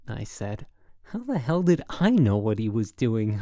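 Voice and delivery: usual speaking voice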